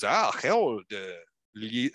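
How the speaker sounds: put-on a halting, half-hearted French accent, given up at once; attempted French accent. A failed French accent as he voices Copeau greeting Harold. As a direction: start a French accent, then drop it. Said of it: "I can't do a French accent".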